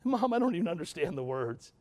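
a hint of a crying kid; upset grandson. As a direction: imitate a frustrated seven-year-old complaining to mom. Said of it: "He's crying".

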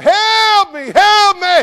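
high, full-voiced holler, drawn out; hollering for help. Man hanging from a cliff branch, hollering for help. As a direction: cry out as loud as you can for rescue. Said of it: "he's hollering out".

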